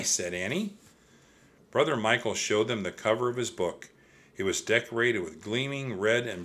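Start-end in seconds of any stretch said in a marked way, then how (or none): none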